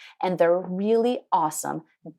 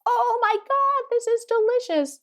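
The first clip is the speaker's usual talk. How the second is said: much higher squealing delight from the buyers; delighted surprise. Retold or imitated. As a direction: imitate their amazed first bite, way up high. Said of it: "they're like".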